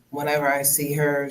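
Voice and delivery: ordinary talk, nothing marked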